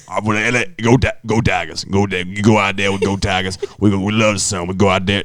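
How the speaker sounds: low halftime pep speech, lightly voiced; Coach O impression. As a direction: pump up the team like Coach O. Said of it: "I do a Coach O impression from L S U".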